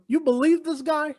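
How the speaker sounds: high-pitched, playful disbelief; mock indignation. Host gripes about his late co-host, mock indignation. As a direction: complain to an imaginary audience, incredulous.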